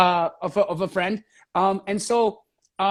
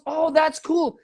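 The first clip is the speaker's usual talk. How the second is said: higher-pitched quote of an impressed partner; impressed reaction; 6 semitones higher, slower than usual. Retold or imitated. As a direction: lift it high, acting out mild delight.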